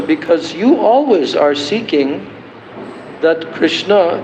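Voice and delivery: ordinary delivery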